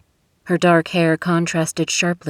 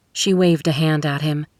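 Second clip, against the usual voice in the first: plain reading voice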